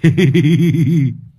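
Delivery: a loud, high burst of laughter; loud cackle. Acted. Long cracking up at his parrot joke, loud high laughter. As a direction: roar at your own joke.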